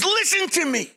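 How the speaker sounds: high, insistent mock outburst; angry wife. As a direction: imitate a wife demanding to be heard. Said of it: "She was angry".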